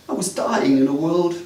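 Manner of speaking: ordinary talk, nothing marked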